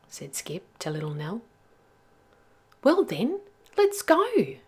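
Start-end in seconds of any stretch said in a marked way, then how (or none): 2.8-4.6 Nell's voice. Acted. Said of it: "she said".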